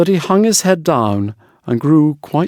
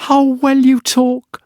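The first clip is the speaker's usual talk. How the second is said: higher, gushing wife, first words slow; a wife flattering her husband, a touch higher; the admiring wife; 11 semitones higher, slower than usual. A narrator's reading.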